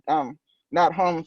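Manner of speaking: plain reading voice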